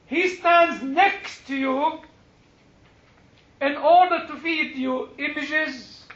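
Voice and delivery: impassioned sermon delivery; preaching. Acted. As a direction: preach it to the congregation. Preaching at full force.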